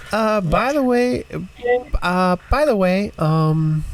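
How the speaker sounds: squeaky-high tiny voice, hesitant starts; little tiny voice. Acted. Game master as someone on the other side with a late warning, little tiny voice. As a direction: call out high and small, stumbling over the opener. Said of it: "little tiny voice coming from the other side".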